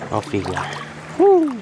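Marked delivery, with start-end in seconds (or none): 1.2-1.6 high loud whoop; excited woo. Real, not acted. Angler cheering a released fish, loud high whoop.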